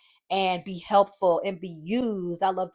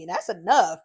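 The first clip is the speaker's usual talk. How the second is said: ordinary talk, nothing marked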